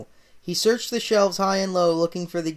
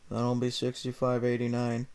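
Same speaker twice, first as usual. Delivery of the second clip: miserable clerk; 6 semitones lower, 6 dB quieter than usual. Acted. Clerk scolds happy Mario, low sour drone. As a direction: grumble it like a sick-of-life clerk.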